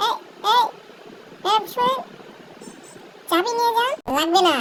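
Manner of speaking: loud call after a woman down the street. Acted. Young man shouting after the madam. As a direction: shout to stop her.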